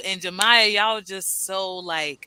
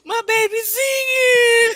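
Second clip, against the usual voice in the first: much higher, slow and loud, near tears; overwhelmed cry; 9 dB louder, slower than usual. Retold or imitated. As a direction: burst out tearfully proud, drawn out. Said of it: "I'm just like".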